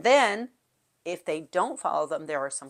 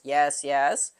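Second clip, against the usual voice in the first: class response; 2 semitones lower, 5 dB louder than usual. Shown as a demonstration. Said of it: "and the class says".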